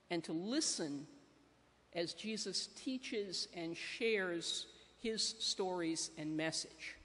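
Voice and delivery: ordinary delivery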